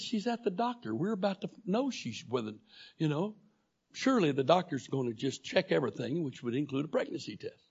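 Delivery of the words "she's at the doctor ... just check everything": excited reply. Retold or imitated. Laughing reply to God's voice.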